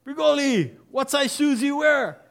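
very high, loud drill call; a sergeant calling out names to the recruits; sergeant shouting. Retold or imitated. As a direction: call the roll at full volume. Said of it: "clothing sergeant calls out again".

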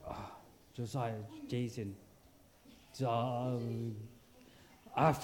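low, soft, hesitant guessing; groping for names. Retold or imitated. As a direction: stall and fumble as you recall a name. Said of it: "you're gonna, like".

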